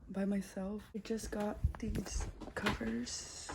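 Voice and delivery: soft whispered mukbang talk; whispering. Real, not acted. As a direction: whisper so nobody wakes.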